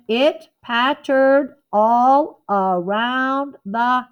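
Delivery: slowly, tapping out each beat; slow counted poem line for the class; counting beats. Shown as a demonstration. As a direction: sound out every beat slowly. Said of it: "let me count it".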